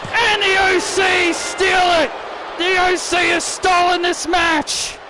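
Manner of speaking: high, excited wrestling commentary; shouted match-ending call. Acted. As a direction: cry out in disbelief at the finish. Commentator shouts the stolen match finish.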